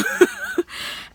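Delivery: squeaky burst of laughter; high laugh. Real, not acted. Laughs at herself.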